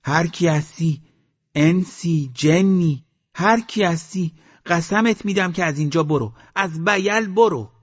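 high, insistent calling into the valley; loud shouting. A narrator's reading. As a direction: shout a warning down to an unseen stranger. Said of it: "بلند داد زد".

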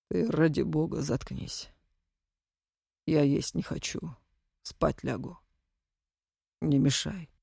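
low, tired and curt; weary, grim man. Acted. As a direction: shut her down, drained. Exhausted husband shuts wife down.

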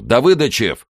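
loud, high-pitched summons; insistent call. Acted. Colonel summoning his lieutenant, insistent shout. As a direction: shout the name across the yard.

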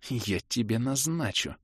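тихо, медленно, с угрозой; прошипи угрозу сквозь зубы; злое шипение. A narrator's reading. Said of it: "зло прошипела баронесса". (зловещее тихое шипение)

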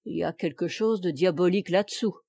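usual speaking voice

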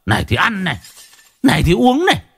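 shrill high ranting while smashing things; furious cursing. Acted. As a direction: spit each này out in a mad rage. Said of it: "vừa chửi vừa đập".

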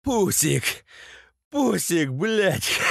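high mock-girlish jeer; mocking echo. A narrator's reading. Beaten captive mocking Masha's name for Vovan, high jeer.

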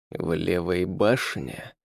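slow, quiet menacing hiss. A narrator's reading. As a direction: hiss it slowly, fangs bared. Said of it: "прошипел он".

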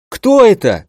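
high, startled cry; alarmed shout. Acted. Liang running through bushes, high startled shout. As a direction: call out in alarm. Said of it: "крикнул Лианг".

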